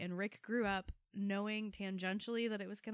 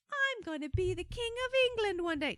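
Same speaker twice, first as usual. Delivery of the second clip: put-on high, loud little boy voice; childish proclamation, pitched way up; kid voice; 13 semitones higher, 9 dB louder than usual. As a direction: play a bossy little kid dreaming big. Kids quoted, child voice.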